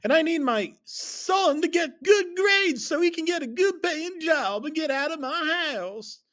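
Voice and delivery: very high, loud mom rant; hammed-up screeching mother; furious mother. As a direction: rant at your son way up high. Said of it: "my mom said".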